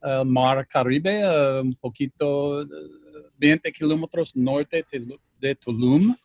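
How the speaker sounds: Spanish with an American accent; careful Spanish, American accent. Real, not acted. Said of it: "perdona mi español".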